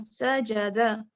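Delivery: सजदा drawn out very slowly, each syllable clear; slow recitation. Shown as a demonstration. Teacher slowly recites सजदा for the class. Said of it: "सीम जबर सा".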